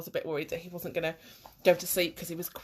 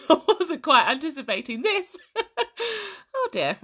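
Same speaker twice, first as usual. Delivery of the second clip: higher, louder, through real giggles; flustered laughing; 8 semitones higher, 6 dB louder than usual. Real, not acted. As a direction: laugh helplessly at the mishap. Flustered by live video not working.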